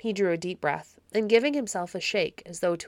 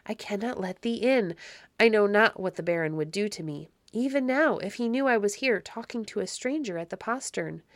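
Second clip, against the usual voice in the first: usual speaking voice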